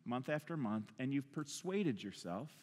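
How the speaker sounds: quiet, heartfelt preacher's reflection; solemn hushed preaching. Real, not acted. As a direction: bring the room down to a grave hush.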